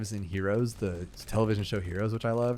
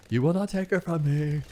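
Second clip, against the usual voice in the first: higher hammed-up villain line; mock Anakin; 6 semitones higher, 4 dB louder than usual. Gamer parodies Anakin's bad acting with hammed-up menace. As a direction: parody the worst acting in the film. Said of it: "it's the worst acting".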